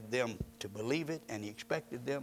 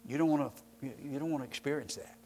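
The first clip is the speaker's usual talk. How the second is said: ordinary talk, nothing marked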